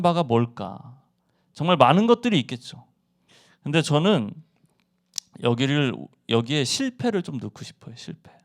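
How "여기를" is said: normal talking voice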